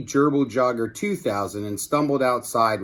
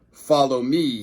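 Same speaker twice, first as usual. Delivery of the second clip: villain command. Acted. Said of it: "he said".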